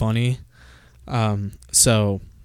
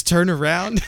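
lightly higher playful command; teasing elf; 6 semitones higher than usual. Retold or imitated. Burly elf teases the player, lightly higher voice. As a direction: coyly tell them to turn. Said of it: "he says".

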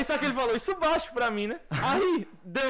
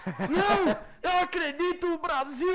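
hopeful fan; 8 semitones higher, 3 dB louder, slower than usual. Retold or imitated. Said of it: "eu falei".

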